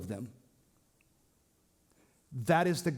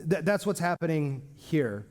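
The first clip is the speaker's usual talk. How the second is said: ordinary delivery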